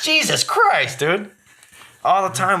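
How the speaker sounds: high exasperated groan; high mock disgust. Finds candy stuck to pants.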